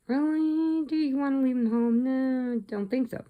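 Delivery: incredulous question. Real, not acted. Teller challenging the movie parents, high and incredulous. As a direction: scoff at the parents in disbelief.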